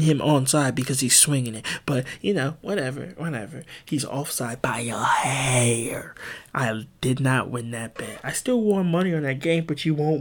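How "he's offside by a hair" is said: unvoiced, slow whisper. Real, not acted. Bettor griping about the offside, in a slow whisper. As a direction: whisper it slowly, still sore.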